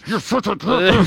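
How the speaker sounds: mock ventriloquist whisper-talk, lips barely moving; ventriloquist hiss. Linda scolding him through a frozen smile, ventriloquist style. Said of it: "trying to do the ventriloquist thing".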